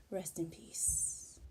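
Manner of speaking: slow, breathy whisper; whispered rest in peace. Retold or imitated. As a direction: whisper it slowly as if laid to rest. Falling asleep, hands folded.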